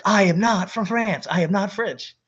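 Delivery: high, loud, offended insistence; indignant denial. Retold or imitated. Roommate furiously denying he's French, high and indignant. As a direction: deny it like an insult. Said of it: "he's like".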